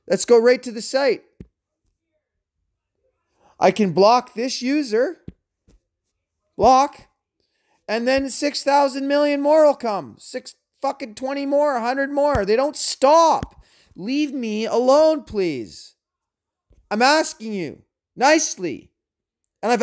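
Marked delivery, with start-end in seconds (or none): 3.6-13.6 building from steady to furious; calm turning angry. Real, not acted. Rant at harassers building to fury. 13.9-15.2 higher, slow angry plea. Real, not acted. Said again in rant.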